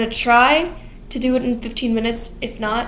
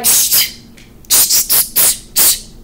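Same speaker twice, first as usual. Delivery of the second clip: repeated tsk; much slower than usual. Retold or imitated. Sweeney shushing Lovett.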